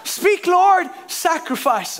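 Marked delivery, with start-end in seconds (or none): 0.0-0.9 loud, high mock-fervent plea; eager prayer. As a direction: call up to God with hammed-up eagerness. Acting eager believer praying to God.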